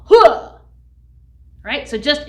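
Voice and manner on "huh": sharp, loud shouted "huh"; chant-ending "huh". Shown as a demonstration. Teacher ending the school chant, loud punched "huh". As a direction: punch out a high, explosive "huh". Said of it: "at the end there is a huh".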